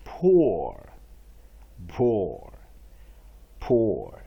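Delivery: "Poor" said low and loud with a falling tune, for the class to repeat; drilled model word. Shown as a demonstration. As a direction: drill "Poor" slowly so they can follow. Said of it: "Please follow me this time".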